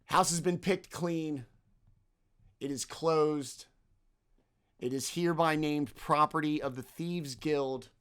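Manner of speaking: formal thief issuing a decree; commanding voice. Acted. Hooded thief claims the house from the party, commanding. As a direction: declare it with measured authority. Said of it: "in a commanding voice".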